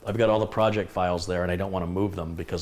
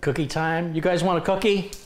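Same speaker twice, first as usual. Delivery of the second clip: much higher and louder sing-song offer; excited pet talk; 9 semitones higher, 5 dB louder than usual. Real, not acted. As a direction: make the dogs excited. Offering cookies to dogs.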